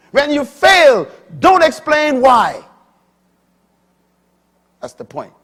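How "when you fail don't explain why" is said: high, very loud pulpit climax; preaching. Acted. Preacher driving the integrity lesson home, full force. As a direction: shout the lesson to the back row.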